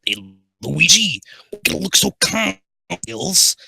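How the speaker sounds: high, loud Italian cartoon plumber; Hotel Mario. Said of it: "Sonic's gonna be Hotel Mario".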